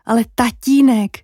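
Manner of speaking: ordinary delivery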